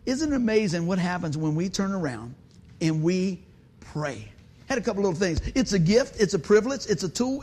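normal talking voice

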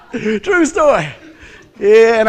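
loud, high, through laughter; real laughing speech. Real, not acted. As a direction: squeeze the words out while cracking up. Laughing at own dentist story.

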